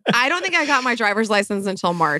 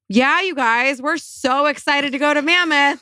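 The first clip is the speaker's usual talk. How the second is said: overplayed fake enthusiasm, pitched up; sarcastically thrilled, higher than usual; sarcastic; 5 semitones higher, slower than usual. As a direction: gush with obviously put-on excitement. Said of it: "it's such a sarcastic conversation".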